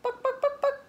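high far clucking chicken voice; chicken clucks. Pretend. Voicing the chickens for a child, high clucks. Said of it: "and I think they say".

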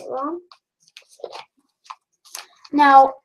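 muffled behind respirator; speaking into mask cartridges; through a face mask. Real, not acted. Seen 4: Respirator covers nose and mouth throughout; removed by +4.5.

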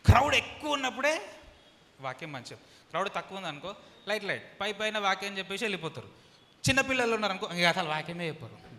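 loud, high sermon voice; preaching at full force. Acted. Pastor scolding crowd-chasing preachers, loud and high. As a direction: hammer the rebuke loudly.

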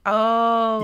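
ordinary talk

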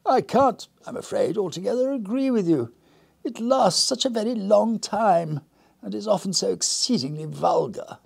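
high, prim and genteel; prim lady voice. Acted. Lady Winslow primly disagreeing with her guest, high and genteel.

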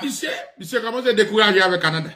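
usual speaking voice

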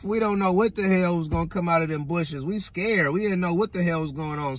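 ordinary talk, nothing marked

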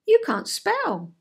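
high, drawn-out put-down; discount. Shown as a demonstration. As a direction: deliver it as a put-down, stretched out. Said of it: "see if you can hear the difference".

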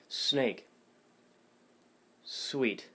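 long whistling S starting "snake" and "sweet"; whistled S. Shown as a demonstration. Practice words for viewers, whistled S on "snake" and "sweet". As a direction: hold and whistle the first sound of "snake" and "sweet". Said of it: "words that start with an S".

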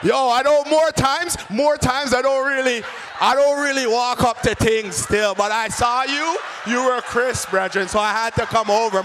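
hesitant, repetitive slangy chat-up; slangy suitor. Acted.

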